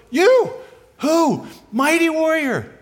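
high, loud incredulous echo; acted disbelief. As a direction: repeat it back as if you cannot believe it. Acting out astonished reply to call.

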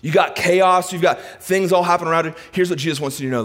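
usual speaking voice

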